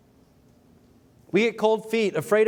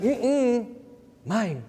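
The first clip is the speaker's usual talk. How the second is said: stingy refusal; 5 semitones higher than usual. Retold or imitated. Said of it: "and like".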